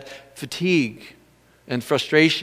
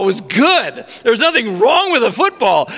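ordinary talk

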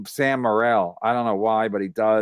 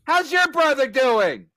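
mocking taunt; 12 semitones higher than usual. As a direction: needle him in a put-on heckler voice.

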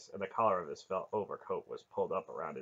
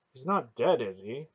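almost sick with worry; 4 semitones higher, 6 dB louder than usual. A narrator's reading. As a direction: start high and worried, then sink. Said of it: "Durnik's voice was almost sick".

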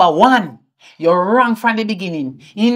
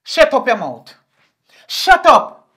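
sharp, high, loud shut-up; mother silencing her daughter's excuses; angry shout; 4 semitones higher, 6 dB louder, slower than usual. Acted. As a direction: cut her off with a yell.

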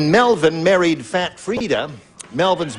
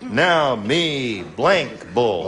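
very slow, lower pidgin chief line; Sitting Bull; 5 semitones lower, much slower than usual. Acted. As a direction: draw out each word in a lower voice. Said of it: "Sitting Bull say".

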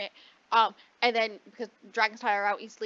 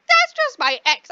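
high, loud mock indignation; shrill it out dismissively; an indignant high brush-off; exasperated protest; 13 semitones higher, 11 dB louder than usual. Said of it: "She's like".

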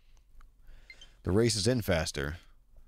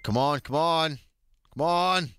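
ordinary talk

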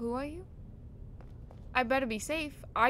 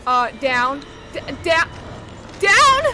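panicked stammer; 2 semitones higher, 12 dB louder, slower than usual. Real, not acted. Panicked fleeing.

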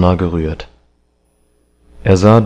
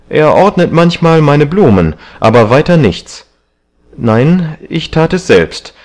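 high, insistent denial; agitated defensiveness; 7 semitones higher, 4 dB louder than usual. A narrator's reading. Young man defending his servant to the painter, agitated. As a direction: protest firmly, pitched up. Dorian defends himself, hiding the picture.

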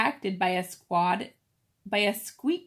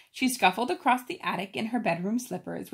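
plain reading voice

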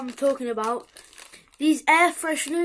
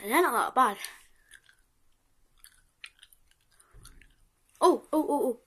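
talking while chewing; chewing candy. Real, not acted. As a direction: mouth partly full of candy. Seen 0: Candy shown earlier, hand to mouth; jaw and lips chewing while talking.

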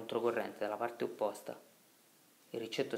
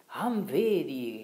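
a slow, drawn-out yell, pitched up; yelling back; 5 semitones higher, 8 dB louder, much slower than usual. Acted. Riccetto shouting back at Agnolo in the boat, slow yell. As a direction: fling the question back as a shout. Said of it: "gridava il Riccetto".

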